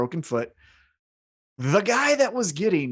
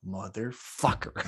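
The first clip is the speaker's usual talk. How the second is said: slow, softer, low muttered curse; low seething curse; 6 semitones lower, 7 dB quieter, slower than usual. Retold or imitated. Champion spotting his hated rival, low seething curse. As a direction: seethe it under your breath. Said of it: "And he's like".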